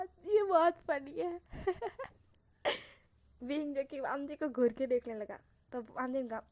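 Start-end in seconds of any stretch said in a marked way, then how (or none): 0.0-1.4 higher, louder, slow and laughing. Acted. Wamji holds up the underwear, laughing slowly. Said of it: "देखते हुए हंसने लगा". 1.4-2.1 high giggle. Acted. Wamji laughing at the underwear, high giggle. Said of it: "हंसने लगा".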